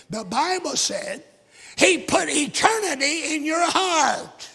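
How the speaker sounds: slow, loud, high sermon climax; preaching at full force. Acted. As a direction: preach it out to the back pew. Preaching peak.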